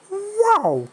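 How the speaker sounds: excited. Real, not acted. Excited exclamation at game.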